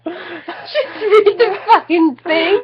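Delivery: a laughing, exasperated demand. Real, not acted. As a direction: beg them to just keep reading.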